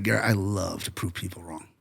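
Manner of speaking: low, hushed quoted voice; James Cameron. Retold or imitated. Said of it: "He's like".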